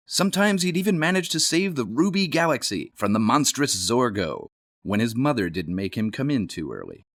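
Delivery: ordinary delivery, nothing marked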